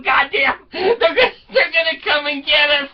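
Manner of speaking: high, hammed-up wail; mock crying. Wife to husband fearing they'll be caught, mock crying. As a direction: wail it in a high, comic panic. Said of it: "she started crying again, going".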